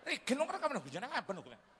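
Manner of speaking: high scolding Javanese mother; mock fussy mum asking what it's called; high mock-Javanese fussing; mother's voice. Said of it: "Emaknya malen".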